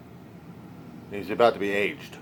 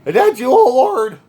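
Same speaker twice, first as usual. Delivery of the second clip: high, loud, overdone Moses answering God; timid Moses; 13 dB louder than usual. Retold or imitated. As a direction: ham up Moses' awestruck reply. Moses answers the burning bush.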